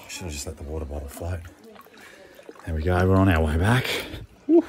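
ordinary talk, nothing marked

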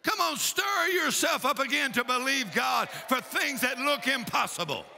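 insistent, driving revival preacher; fired-up preaching. Real, not acted. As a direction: urge the congregation on with conviction. Preaches to the congregation.

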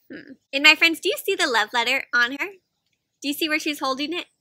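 usual speaking voice

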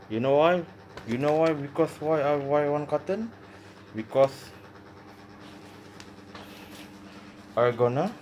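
talking around a cigarette; cigarette in mouth. Real, not acted. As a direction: lips clamped on cigarette. Seen 2: Unlit cigarette held between lips throughout while talking.

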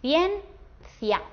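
«Ciencia» en dos sílabas, cien-cia, con pausa en medio; separado por sílabas. Shown as a demonstration. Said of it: "lo haríamos así". («Ciencia» silabeado, cien... cia)

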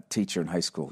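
ordinary talk, nothing marked

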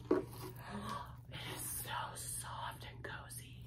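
slow, breathless whisper. Real, not acted. Vlogger marvelling at the soft scarf, whispering slowly. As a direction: hush it out slowly as you feel the scarf. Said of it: "Why am I whispering?".

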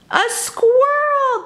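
high, slow delighted cry; children spotting a squirrel; excited shout. Acted. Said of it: "the kids shouted".

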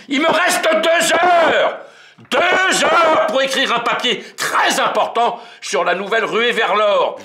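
high, loud, slow desperate yell; screaming about the deadline; shout. Acted. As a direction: bellow it out in frustration. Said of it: "Je, je crie".

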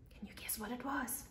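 a hushed, secret aside; whispered question. Acted. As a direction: whisper it to the kids, teasing. Teacher asks the children.

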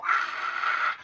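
loud yelp of pain; sudden loud "ah"; pained yell. A narrator's reading. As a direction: cry out as if scraped. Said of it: "yelled Haley".

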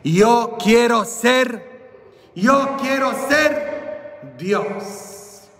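high, slow, stretched proclamation; boy's grand declaration. Acted. Small boy announces his ambition to the bishop, grand declaration. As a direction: declare it high and drawn out, pausing before the last word. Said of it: "Y yo le dije".

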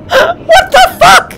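high, slow, laughing outburst; giggling stunned question; laughing disbelief. Real, not acted. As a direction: laugh through the shock.

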